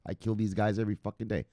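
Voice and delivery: ordinary talk, nothing marked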